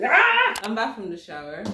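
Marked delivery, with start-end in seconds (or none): 0.0-0.6 loud high shriek; pained scream. Real, not acted. Vlogger with burning scalp, shrieking. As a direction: scream out as the burn hits.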